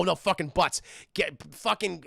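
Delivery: normal talking voice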